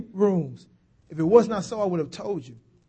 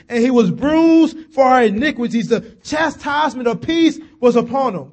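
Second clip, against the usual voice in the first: louder, impassioned sermon lines; fervent sermon reading; rising preaching; 4 semitones higher, 8 dB louder than usual. Acted. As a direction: build the passion story.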